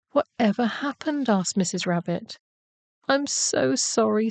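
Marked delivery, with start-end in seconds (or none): 0.1-1.3 higher-pitched concerned question; worried mother rabbit. A narrator's reading. Missus Rabbit asks her rescued son, worried. 3.1-4.3 higher, slow, tearful little bunny; mild sob. A narrator's reading. Samuel says sorry to his mother, lightly sobbing. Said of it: "sobbed Samuel".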